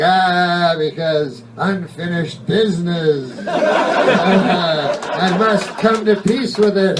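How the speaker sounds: drawn-out ghostly whine; hammed-up whiny spirit. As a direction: moan self-pityingly like a restless ghost. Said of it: "they're whiny, those fucking ghosts".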